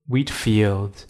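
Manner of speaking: ordinary delivery, nothing marked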